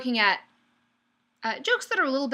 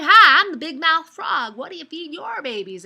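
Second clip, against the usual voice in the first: big-mouth frog; 5 semitones higher, 10 dB louder than usual. Acted. Frog greets a cow on the road, big-mouthed. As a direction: open wide and boom it out like a cartoon frog. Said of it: "he speaks with a really big mouth".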